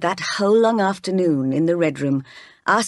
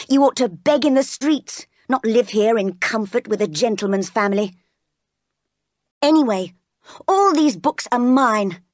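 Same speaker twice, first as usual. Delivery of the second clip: haughty tirade in a raised pitch; bullying young master; 4 semitones higher than usual. Acted. John putting Jane in her place, haughty bullying. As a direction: lord it over the poor relation.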